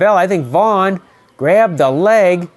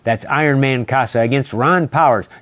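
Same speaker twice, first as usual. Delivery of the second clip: lower, measured promo announcer voice; ad read; 5 semitones lower than usual. Acted. Promoting Saturday's title match to viewers, ad read. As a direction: sell the card like a TV spot. Reads event promo with phone number.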